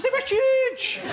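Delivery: squeaky high-pitched mock voice; silly high voice. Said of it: "I don't know why I said it in that voice".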